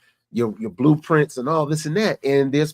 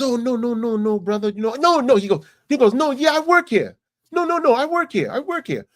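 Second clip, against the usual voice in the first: high, insistent hustler voice, louder at the end; hustler denying; 9 semitones higher, 4 dB louder than usual. Retold or imitated. Hustler flipping his story to the tourist, high insistent. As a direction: keep insisting you work here.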